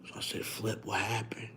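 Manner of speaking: retelling a call with Flip in a hushed mafia voice; talking low like the mafia. Retold or imitated. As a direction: keep it hushed like a mob boss. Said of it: "they talk low".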